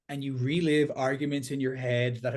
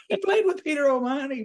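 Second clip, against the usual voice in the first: much higher, fast and louder, disbelieving; incredulous; 12 semitones higher, 5 dB louder, faster than usual. Real, not acted. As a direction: shout it up high in total disbelief. Incredulous outburst about a rugby claim.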